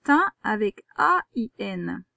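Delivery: "Tin avec A I N" slow, each letter of A I N separate; spelling out letters. Shown as a demonstration. Teacher to pupil spelling tin with A I N. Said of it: "c'est tin".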